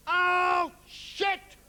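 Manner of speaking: high, drawn-out yelp of disgust; sudden dismayed outcry. Retold or imitated. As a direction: cry out as if you stepped in something. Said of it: "he suddenly goes".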